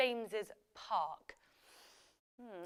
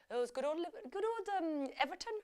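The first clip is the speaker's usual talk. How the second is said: higher, badly faked Scouse; amateur Scouse impression, pitched up; bad Liverpool accent; 5 semitones higher, 5 dB quieter than usual. Shown as a demonstration. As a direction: attempt a Liverpool accent and miss. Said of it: "That's a really bad accent".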